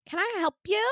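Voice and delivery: high, put-on snooty staff voice; gum-chewing nurse. Staffer answering the bathroom help button, high put-on attitude. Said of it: "Miss Chewy Gum Long Nail bitch".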